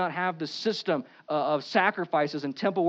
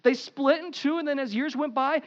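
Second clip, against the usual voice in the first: ordinary talk, nothing marked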